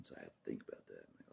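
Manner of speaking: ordinary talk, nothing marked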